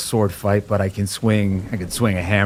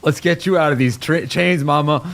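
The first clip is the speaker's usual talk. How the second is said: urgent rescue; 8 semitones higher than usual. Acted. Son tearing the chains off his mother, high urgent strain. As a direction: heave at the chains, urging.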